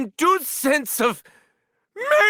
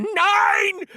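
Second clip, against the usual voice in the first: shouted nine; 7 semitones higher than usual. Acted. Repeated count, second shouted.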